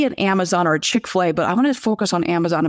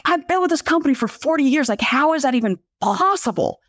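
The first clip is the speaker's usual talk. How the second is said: higher-pitched, incredulous retold complaint; exasperated disbelief; 7 semitones higher than usual. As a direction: re-enact your baffled exasperation. Quoting own disbelief at the company.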